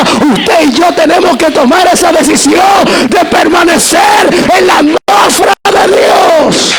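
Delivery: rising, slowing preacher's shout; preaching shout. Real, not acted. Preacher exhorting the congregation with a rising shout. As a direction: drive it home to the congregation, full voice.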